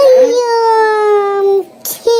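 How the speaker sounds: a sudden high wailing cry; exaggerated lament; mock wail. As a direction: cry out in hammed-up grief. Mother-in-law lamenting.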